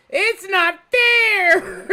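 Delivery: high, slow mock complaint through laughter; giggling fake protest; laughing whine. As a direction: whine it while cracking up. Mocking whine while laughing.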